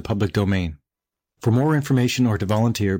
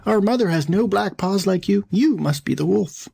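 put-on high young-goat voice refusing; kids crying out; 8 semitones higher than usual. A narrator's reading. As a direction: accuse the wolf as a defiant little kid. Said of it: "cried out".

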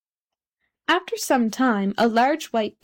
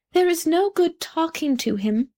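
lightly voiced dragonfly, higher pitch; a hint of a chatty dragonfly, pitched up; dragonfly character; 7 semitones higher than usual. A narrator's reading.

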